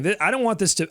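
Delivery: ordinary talk, nothing marked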